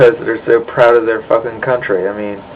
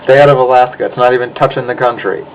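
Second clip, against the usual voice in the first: usual speaking voice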